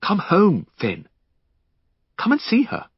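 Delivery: high, imploring appeal; earnest plea. Acted. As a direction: beg him gently to come back. Henry begging Finn to return.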